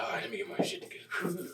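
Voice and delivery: breathy whisper psyching up; nervous whispered pep talk; whispered to himself. Retold or imitated. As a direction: whisper it under your breath. Nervous self-talk before stage.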